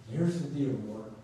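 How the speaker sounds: usual speaking voice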